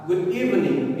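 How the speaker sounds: "evening" stressed on its first syllable, then falling. Shown as a demonstration. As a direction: hit the E of "evening". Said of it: "words kedua ya".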